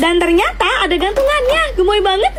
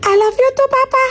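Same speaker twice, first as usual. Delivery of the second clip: ordinary talk, nothing marked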